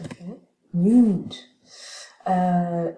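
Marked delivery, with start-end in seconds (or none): 0.7-1.5 "Mute" said alone, loud and falling; stressed word demo. Shown as a demonstration. Teacher models the stressed syllable for students, loud and falling. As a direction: hit "Mute" firmly as one stressed syllable. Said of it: "l'accent est plutôt sur mute".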